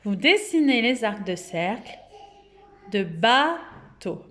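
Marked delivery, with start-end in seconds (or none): 3.0-4.3 "bateau" slowly as baa, pause, teau; slow, separated "ba-teau"; syllable by syllable. Shown as a demonstration. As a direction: hold "ba", then place "teau" apart. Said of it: "vous comptez les syllabes du mot".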